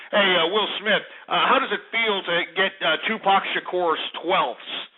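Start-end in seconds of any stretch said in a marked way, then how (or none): none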